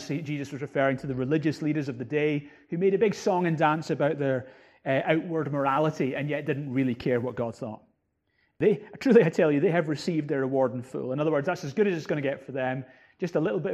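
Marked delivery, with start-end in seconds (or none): none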